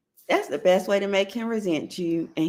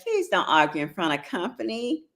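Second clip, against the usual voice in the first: usual speaking voice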